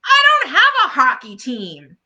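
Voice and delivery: mock praise; sarcastic complaint. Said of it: "Peppermint Patty complains".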